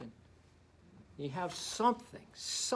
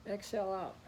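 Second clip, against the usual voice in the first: usual speaking voice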